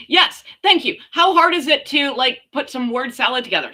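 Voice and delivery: high, loud fed-up exclamation; loud exasperated yes, thank you; exasperated outburst. Real, not acted. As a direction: blurt it out, fed up and incredulous. Exasperated rant about word salad.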